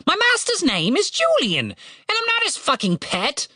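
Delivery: high, indignant bird voice; demanding raven. A narrator's reading. Raven objects to being called a pet, demanding. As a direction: squawk the correction indignantly. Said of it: "demanded Ravenous".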